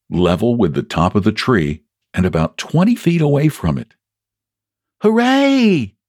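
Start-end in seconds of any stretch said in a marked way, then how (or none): 5.0-5.9 high-pitched storybook cheer; excited shout. A narrator's reading. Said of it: "you shouted".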